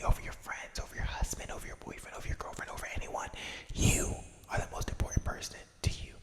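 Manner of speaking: ordinary delivery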